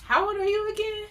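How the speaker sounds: high, fast mock-innocent ask; innocent question. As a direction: ask sweetly, fishing for truth. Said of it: "I'm gonna ask you this one more time".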